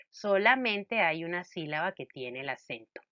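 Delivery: ordinary delivery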